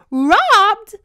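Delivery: shrill, very loud high yelp; shocked squeal. Retold or imitated. Heroine learning of the robbery, shrieking. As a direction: shriek the word in disbelief.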